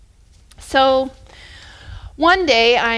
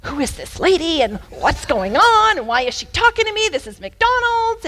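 high-pitched, incredulous acted questioning; bewildered boy; 6 semitones higher, 3 dB louder than usual. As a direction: imitate a boy baffled by a stranger. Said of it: "And he started looking".

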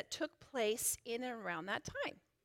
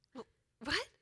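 puzzled dad; 7 dB quieter than usual. Retold or imitated. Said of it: "my dad turns around, and he looks".

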